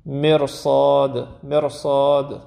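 مرصاد very slowly, the ر thick and heavy; drawn-out مرصاد with the ر full-mouthed. Shown as a demonstration. Quran teacher slowly modeling the heavy R in مرصاد. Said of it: "همون غلیظ بگید".

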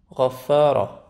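غفارا with the فا kept thin and front; heavy غ, light فا, heavy را in غفارا; correct light FA. Shown as a demonstration. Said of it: "فا ممکنه یه دفعه حواستون نباشه". («غفارا» با فای نازک)

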